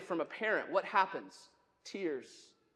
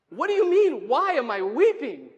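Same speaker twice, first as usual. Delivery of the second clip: loud indignant disbelief; 3 semitones higher, 7 dB louder than usual. Acted. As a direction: throw the question back forcefully in protest. Acted protest, building after quiet take.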